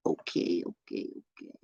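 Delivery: barely audible, voiceless murmur; muttering to himself. Real, not acted. Streamer to himself between chat messages, murmuring very softly. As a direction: whisper it absently under your breath.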